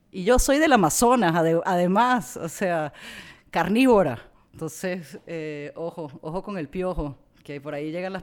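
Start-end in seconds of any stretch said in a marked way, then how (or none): 0.1-4.2 loud, high mock-fierce boast; playful loud comeback. Real, not acted. Joking back at ignorant Americans, loud and high. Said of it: "joking comeback about Amazon".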